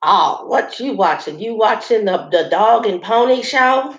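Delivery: slow, lightly drawled Southern man, a hint of the accent; lazy Southern drawl imitating the stranger, only lightly put on. Imitating the Southern stranger's drawl to her viewers, lightly. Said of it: "he's got the heavy Southern drawl".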